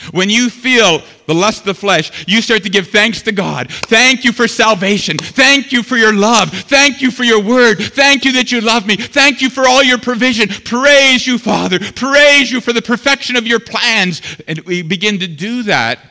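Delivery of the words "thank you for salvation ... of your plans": preached prayer. Shown as a demonstration. Preacher demonstrating a thankful prayer, loud and fervent. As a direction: lift each thank you up to God.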